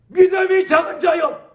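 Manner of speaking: high, loud rebuke; shouted rebuke. Retold or imitated. Jesus rebuking his disciples in the storm, shouting high. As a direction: bellow it like a stern master.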